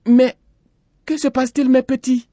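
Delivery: very high-pitched mother voice; high, loud motherly character; worried mother. A narrator's reading. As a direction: ask the children in high alarm. Mother asks her alarmed children.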